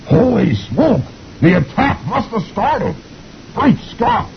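high slow cartoon exclamation; startled sergeant. Sergeant sees the dust cloud, high alarmed exclaim. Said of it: "He says".

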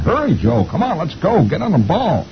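high-pitched, urgent nagging; bossy wife. A narrator's reading. Wife rushes husband to the seats, urgent nagging. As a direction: hurry the husband along in a high voice. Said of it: "She says".

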